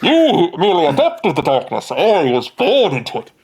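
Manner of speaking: muffled, theatrical masked-villain voice; hammed-up villain, drawing out the boast; Bane. Game master riffing on the masked man, Bane impression. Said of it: "like you're Bane".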